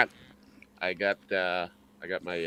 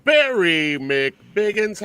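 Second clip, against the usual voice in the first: slow announcer intro; 9 semitones higher, 6 dB louder, much slower than usual. Acted. Drawn-out host greeting.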